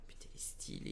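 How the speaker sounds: very soft, almost whispered awe; hushed admiration. Real, not acted. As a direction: murmur it in admiration. Admiring a card just revealed. (admiration murmurée, presque chuchotée)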